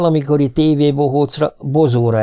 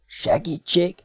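thin, hushed little voice; small, high whisper; 6 semitones higher, 6 dB quieter, slower than usual. A narrator's reading. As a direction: softly whisper a faint plea. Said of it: "suttogta ekkor tőle balra egy egészen halk, vékony hang".

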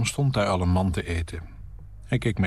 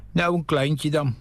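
character voice; 6 semitones higher than usual. Acted. Man accepts a herring.

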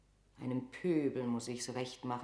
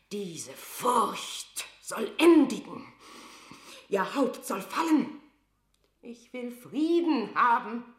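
loud, high, slow vow of death; queen shouting her rival's doom; furious outburst; 9 semitones higher, 10 dB louder than usual. Acted. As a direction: spit out the verdict like a sentence. Queen's vengeful outburst in monologue.

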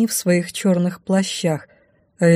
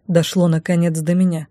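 usual speaking voice

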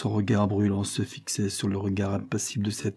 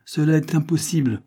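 firm, final refusal, pitched up; firm and decided; 6 semitones higher than usual. Acted. Levison refusing Isabel's plea, firm and decided. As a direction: shut her down with a decided no. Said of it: "d'une voix ferme et décidée".

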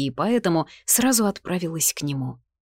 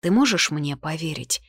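ordinary delivery

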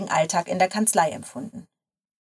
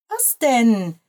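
higher, drawn-out challenge; indignant question; 4 semitones higher, 4 dB louder than usual. A narrator's reading. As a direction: push back at the friend's amusement. Grace indignantly answers amused friend. (empört nachgefragt)

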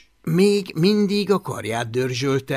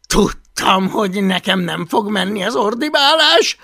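louder, higher inner complaint; frustrated self-reproach; 7 semitones higher, 7 dB louder than usual. Acted. Boy thinks his yelling has failed, frustrated. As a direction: complain to yourself, pitched up.

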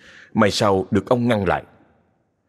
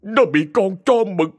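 higher, pleading, voice catching with grief; a desperate father begging the master; choked up; 8 semitones higher than usual. Acted. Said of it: "giọng nghẹn ngào". (giọng nghẹn ngào van xin, cao hơn)

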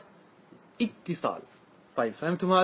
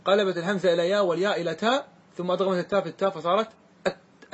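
ordinary talk, nothing marked

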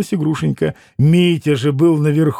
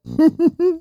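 laughter; 13 semitones higher than usual. Acted. Laughter after the joke.

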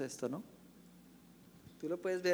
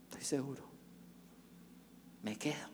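quiet resolve; 10 dB quieter than usual. Acted. Peter quietly commits to stay with Jesus. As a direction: answer with humble firmness.

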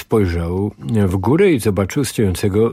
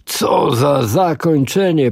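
slow, somewhat higher TV-host opening; Mike Terry; 6 semitones higher, slower than usual. Acted. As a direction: savour it like a showman. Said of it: "przemawiał głosem Mike'a Terego".

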